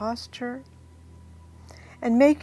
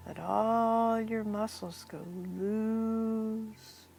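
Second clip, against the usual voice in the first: guided hypnosis; 5 dB quieter than usual. Acted. Leads a guided hypnosis induction.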